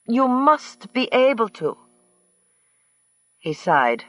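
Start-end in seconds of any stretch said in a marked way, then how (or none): 0.1-1.8 high, put-on foreign-accented woman; Earhart's voice. A narrator's reading. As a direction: voice her high and mannered over the phone.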